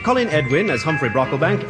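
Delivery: ordinary talk, nothing marked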